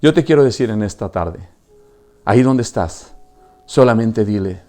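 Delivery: normal talking voice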